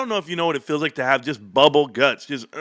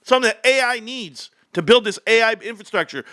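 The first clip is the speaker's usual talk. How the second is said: higher, mock-grand company spokesman; 8 semitones higher than usual. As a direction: parody Nvidia's boast, pitched up. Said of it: "they said".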